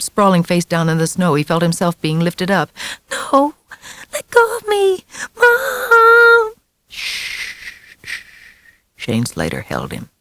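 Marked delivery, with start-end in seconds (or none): 3.1-6.5 high, loud crying scream; terrified scream. A narrator's reading. Boy seized by the stranger screaming for his mother. 6.9-8.2 breathy low shush; hushing. A narrator's reading. Man hushing the struggling boy.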